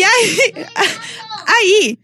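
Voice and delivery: high squealing laugh near tears; helpless cry-laugh at the memory; shrieking laugh. Real, not acted. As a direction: laugh so hard it almost cries. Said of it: "cracking up at her story".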